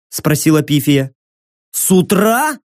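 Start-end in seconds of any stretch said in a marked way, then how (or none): 1.7-2.6 puzzled. Acted. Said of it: "недоуменно хлопнул глазами парень".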